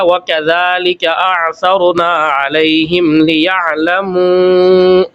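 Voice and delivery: تلاوة مجودة; tajweed recitation. Shown as a demonstration. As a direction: recite it the way just taught. Recites the verse after explaining.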